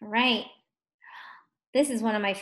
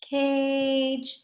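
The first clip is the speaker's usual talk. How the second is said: slow sounded out; 2 semitones higher than usual. Shown as a demonstration. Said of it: "You say it slow".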